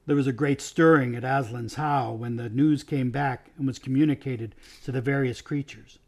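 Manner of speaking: plain reading voice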